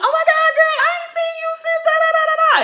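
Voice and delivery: very high, squealed gushing greeting; high-pitched fake girlfriend gush; mock gushing girl. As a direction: squeal it like a fake-excited friend. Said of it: "I'm like".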